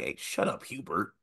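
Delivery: hushed, half-whispered mock jab; muttered heckle. Someone needling an upset coach, hushed mock heckle. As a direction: mutter it under your breath as a pretend heckler. Imagined heckler in handshake line.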